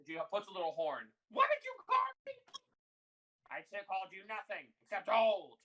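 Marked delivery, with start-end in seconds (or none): 1.3-2.3 very high, fast, loud screech; hollering halfling. Acted. Tiny halfling Delilah challenges her sister after fitting horn, screeching. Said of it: "hollering at each other". 3.5-5.6 high, loud squabbling; hollering. Acted. Old sister squabbling with hard-of-hearing Delilah, hollering. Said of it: "hollering at each other".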